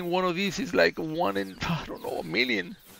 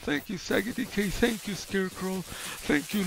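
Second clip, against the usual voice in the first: ordinary talk, nothing marked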